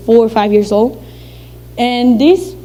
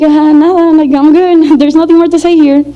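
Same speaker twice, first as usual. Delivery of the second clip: nervous brush-off; 5 semitones higher, 4 dB louder than usual. Retold or imitated. Child nervously brushing off stepmom.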